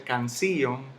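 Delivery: "canción" split as can-SÍ-on; misplaced stress. Shown as a demonstration. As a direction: stress the I of "canción" as a separate syllable, wrongly. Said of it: "no se dice".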